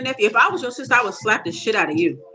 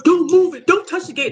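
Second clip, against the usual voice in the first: mock yell; 7 semitones higher than usual. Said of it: "He yelling down".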